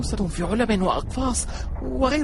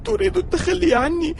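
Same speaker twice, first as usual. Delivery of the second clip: whiny fake crying; 7 semitones higher than usual. Friend guilt-trips, fake crying.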